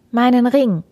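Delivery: the daughter quickly offers her ring. Acted. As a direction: get it out fast. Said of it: "sagte die Müllerstochter schnell".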